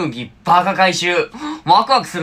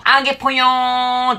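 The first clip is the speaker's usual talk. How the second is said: japanese gyaru slang; 6 semitones higher than usual. Real, not acted.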